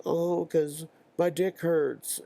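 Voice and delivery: slow, high mock kid voice; complaining kid. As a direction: drag out the complaint, pitched high. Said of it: "Joey would be like".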